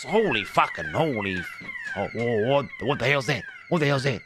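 loud startled outburst, pitch jumping up. Acted. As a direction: react as if an alarm just blared. Alarm suddenly goes off.

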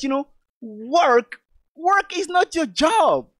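slow preacher's emphasis on work; emphatic stress on work. Real, not acted. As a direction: work drawn out slowly and pitched high. Preacher stressing the key word.

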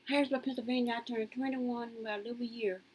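ordinary talk, nothing marked

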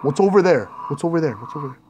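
from normal voice down to a breath; fading fearful question. Real, not acted. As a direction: let fear swallow the question. Repeats, fading into fear.

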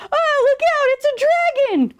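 high, loud cry of warning; frightened animals raising the alarm. A narrator's reading. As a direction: cry out in storybook panic. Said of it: "cried the other animals".

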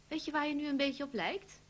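a mild hammed-up teasing husband. A narrator's reading. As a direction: tease her cheerfully. Bas teasing his wife.

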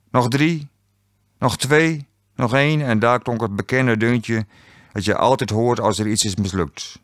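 usual speaking voice

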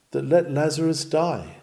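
usual speaking voice

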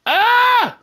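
sudden high scream of fright; startled scream. Real, not acted. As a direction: shriek as if jumped by something. Jump scare in the game.

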